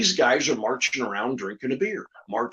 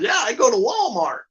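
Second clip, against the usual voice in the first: puppet voice; 7 semitones higher than usual. Retold or imitated. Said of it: "He goes".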